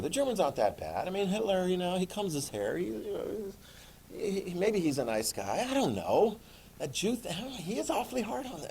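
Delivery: soft, hesitant excuse-making; hushed, uncertain, pitch creeping up; wavering apologist. Acted. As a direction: hem and haw quietly in someone's defense. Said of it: "British and French people going".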